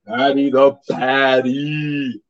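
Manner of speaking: very slow, stretched-out name call; mock announcer drawl; echoed hype call. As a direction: stretch each word out in a playful echo. Co-host echoes the hyped name call.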